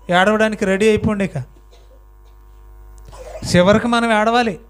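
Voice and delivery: normal talking voice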